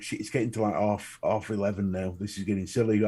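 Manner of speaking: low, soft thinking-to-himself voice; muted inner grumble. Retold or imitated. Guest realising how late it is getting, quiet inner grumble. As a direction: keep it under your breath, low.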